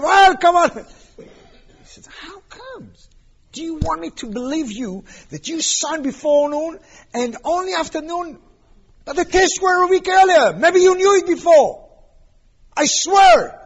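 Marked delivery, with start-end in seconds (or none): 0.0-0.7 high, loud, indignant retort; angry retort. Retold or imitated. Business partner brushing Michael off, loud angry retort. 2.1-13.6 high, loud accusing rant that builds to a shout; angry accusation. Retold or imitated. Partner accusing Michael of hiding his tumor, shouting angrily.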